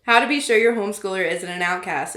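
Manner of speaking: ordinary talk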